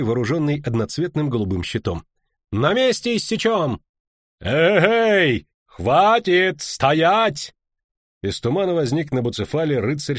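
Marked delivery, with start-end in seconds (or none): 2.5-7.5 high, loud battle cries; shouted threats. A narrator's reading. Knights to hidden travellers, shouted threats. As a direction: bellow orders to halt.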